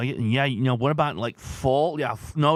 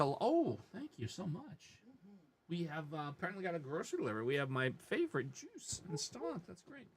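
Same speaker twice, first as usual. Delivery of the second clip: much softer, away from the mic; off-mic aside; 18 dB quieter than usual. Real, not acted. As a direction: quietly thank someone off to the side. Aside to someone off the stream.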